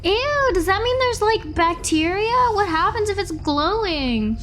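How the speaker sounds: high disgusted worry; grossed out. Acted. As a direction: squeal it out, grossed out. Glowing cut, disgust.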